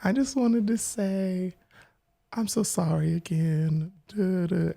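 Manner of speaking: high, soft, hesitant mock apology; retold timid apology. Friend apologizing on an awkward call, retold timidly. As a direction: lightly imitate her small, halting voice.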